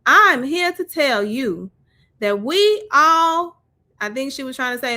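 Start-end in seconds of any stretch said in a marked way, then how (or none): 0.1-3.6 lightly put-on Southern accent, a bit higher and slowed; a hint of Mississippi Southern accent; mock Southern voice. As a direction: read the mother's letter in a light Southern drawl. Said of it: "I gotta read it in that Southern voice".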